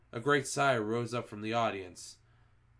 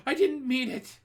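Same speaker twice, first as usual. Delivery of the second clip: much higher, louder begging; pleading cry; 6 dB louder than usual. A narrator's reading. Culprit begging the Grand High Witch, high loud pleading. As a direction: beg for forgiveness.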